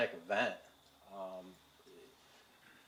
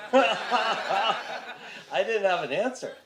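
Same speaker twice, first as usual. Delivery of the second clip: laughing; 8 semitones higher, 6 dB louder, much slower than usual. Real, not acted. Stunned admission, laughing.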